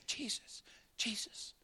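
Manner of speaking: ordinary delivery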